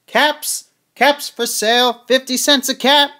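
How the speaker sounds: high, loud street-seller's cry; calling out. A narrator's reading. Peddler sells caps in town, calling out. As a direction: hawk the caps to the whole town.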